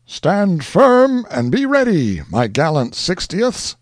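high slow commanding call; rally the troops from above; sudden exclaim. Acted. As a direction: commander exclaims to his regiment. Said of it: "suddenly exclaimed a voice above them".

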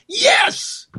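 sudden high cry of joy; excited cheer. Real, not acted. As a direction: shout it out in delight. Cheers idea of abolishing IRS.

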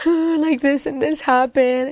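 high-pitched, lightly imitated crying voice; retold tearful confession. Confiding about stepdad to the preacher, retold tearful confession. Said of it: "I just began crying".

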